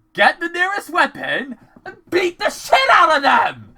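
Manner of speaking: high, loud urging; exasperated yell. Acted. Viewer telling Mike to fight monsters, exasperated yell. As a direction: yell advice at a hopeless character.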